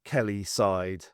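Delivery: plain reading voice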